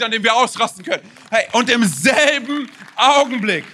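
laute, begeisterte Predigt, Schlüsselwörter gedehnt; predigen mit voller Kraft. Real, not acted. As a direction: preach it loud to the whole church. (kraftvoll predigend)

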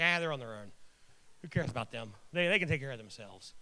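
low, offhand brush-off; joking, low-pitched indifference; dismissive. Retold or imitated. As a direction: wave them away like they don't matter. Joking dismissal of his own brothers.

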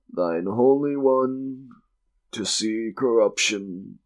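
slow, drawn-out priestly chant, starting high and settling; intoned prayer. Acted. Priest walking before the condemned man, intoning prayer. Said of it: "The priest mumbling prayers".

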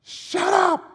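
shout. Retold or imitated. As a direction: snap at him to shut up. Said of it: "And she was like".

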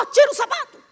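loud, high nagging orders; mocking a nagging wife ordering her husband; bossy wife. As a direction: boss him around. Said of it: "ela fala".

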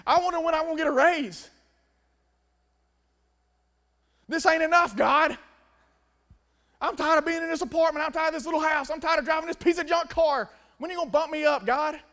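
high, loud mock complaint; acted griping. Joy tired of apartment and car, loud mock griping to God. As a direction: whine up to heaven, fed up.

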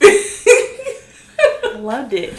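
laughing. Real, not acted. Gushing about his laugh.

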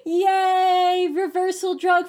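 high, bright mock cheer; sarcastic yay. Mock celebrating the reversal drug.

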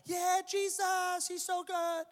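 high, slow, drawn-out worship singing; mock worship singer. Pastor imitating the worship team, high and slow. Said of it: "Worship has started".

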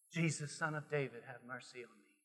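low, very soft cry from the depths; hushed plea. Acted. Preacher as blind man calling from his soul, hushed plea. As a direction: let it sink almost to nothing.

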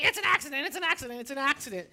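put-on rapid repeated kid's protest, only mildly yelled; mock kid yelling. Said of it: "that kid yelling".

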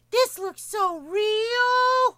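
very high, slow gasp of wonder; childlike awe. Retold or imitated. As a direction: gush like an amazed little kid. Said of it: "I was like".

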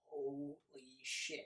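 drawn-out, soft "holy shit"; stunned under your breath; stunned audience member, slow and hushed; awed hush. Retold or imitated. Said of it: "you're just like".

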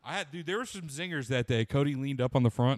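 ordinary talk, nothing marked

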